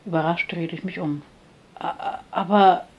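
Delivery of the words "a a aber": halting, stuttered attempt to object; stuttering protest, broken into pieces; stammering. A narrator's reading. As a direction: stammer on the first sound, slowly. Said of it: "stotterte ich".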